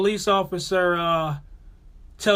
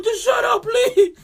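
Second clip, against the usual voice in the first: high, near-crying shout; high panicked crying plea; desperate plea; 5 dB louder, slower than usual. Retold or imitated. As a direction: beg in a cracking panic. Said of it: "The face he made".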